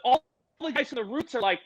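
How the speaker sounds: ordinary delivery, nothing marked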